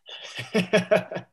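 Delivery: high, breathy chuckle; short real laugh; amused laugh. Real, not acted. Guest recalling baffling American jokes, amused laugh.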